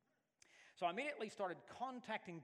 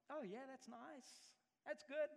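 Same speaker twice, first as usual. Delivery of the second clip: syrupy sarcasm before the threat; sarcastic fake sweetness; 9 semitones higher, 3 dB quieter than usual. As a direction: sneer it out as a too-nice older brother. Mocking a brother's fake niceness.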